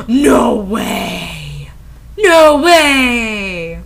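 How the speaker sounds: gasp, then drawn-out feigned disbelief; mock shock. Reader mocking the break-up revelation, in fake shock.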